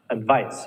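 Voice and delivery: "Advice" with a weak əd, stress on VICE; schwa in advice. Shown as a demonstration. Teacher models "advice" with a schwa start. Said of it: "Ad, not ad".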